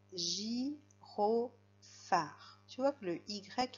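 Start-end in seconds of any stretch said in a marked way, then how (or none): 0.1-1.5 slow dictation word. Shown as a demonstration. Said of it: "Ensuite, un".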